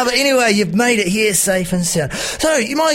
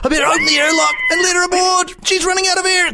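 excited pirate; 11 semitones higher than usual. Acted. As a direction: gasp and shout with delight, pirate style. Pirate amazed at Emma arriving.

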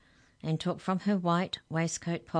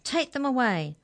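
high, raised-voice command; ordering; 9 semitones higher, 5 dB louder than usual. A narrator's reading. Hex checker ordering the giants removed, commanding. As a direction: call out the order sharply. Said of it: "ordered the hex checker".

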